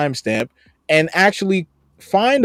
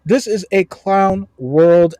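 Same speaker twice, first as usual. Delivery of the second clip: slow emphasis; 3 semitones higher, much slower than usual. Real, not acted. Mocking the commenters.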